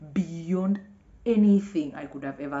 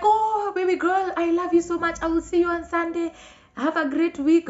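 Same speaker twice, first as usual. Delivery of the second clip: high, sweet singsong farewell; Daisy's goodbye; 12 semitones higher, 5 dB louder than usual. Retold or imitated. Friend Daisy saying goodbye after church, high sweet singsong. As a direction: gush it like a doting friend.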